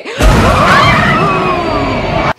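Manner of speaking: high, loud scared cry; frightened shriek. Real, not acted. Scared by floating woman.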